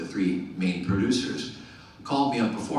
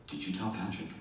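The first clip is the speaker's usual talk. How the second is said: hushed, ominous question; soft imitation of an upset co-star; retold quiet confrontation; 2 semitones lower, 11 dB quieter than usual. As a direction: ask it softly, as a held-back accusation. Co-star confronting producers by phone.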